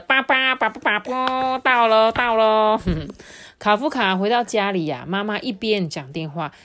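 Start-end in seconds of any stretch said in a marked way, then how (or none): 0.0-2.8 loud, higher toy-horn tooting; imitated horn honks. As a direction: honk like an ice-cream truck horn. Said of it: "他这个巴布巴布是他的".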